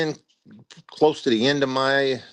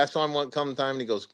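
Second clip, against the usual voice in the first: ordinary talk, nothing marked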